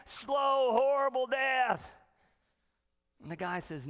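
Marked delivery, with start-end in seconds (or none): 0.1-1.9 high, loud, drawn-out mock wail; frantic doom. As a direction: stretch out the horror in exaggerated panic. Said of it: "one guy is just frantic".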